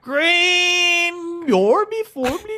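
high, drawn-out nonsense Swedish singsong; hammed-up Swedish Chef yell; mock Swedish heckler. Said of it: "did he go".

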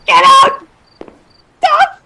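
high, loud, urgent yell; real alarm, yelled high; panicked shout. Real, not acted. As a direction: shriek orders at the player. Panicking, yelling at the player.

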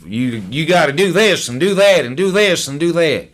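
lightly mock their repetitive lecturing; mimicked nagging advice. Meeting regulars lecturing the newcomer, lightly mimicked as nagging.

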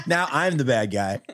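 ordinary talk

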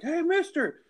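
high mock call across the street. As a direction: hail the rescuer from afar. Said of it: "He's like".

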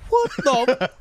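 high, loud shocked outburst; hammed-up stunned yelp; alarmed disbelief. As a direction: react in mock horror. Alarmed reaction to screaming in story.

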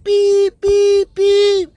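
very high, loud beeping like a reversing truck; truck beep imitation. Imitating the truck backing up, high beeps. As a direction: mimic a reversing truck's beeper.

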